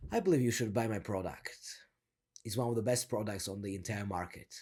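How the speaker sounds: low, controlled sales pitch; down talk, slowing at the end. Shown as a demonstration. Coach models selling a product, in control, down talk. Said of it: "how I'm slowing down at the end".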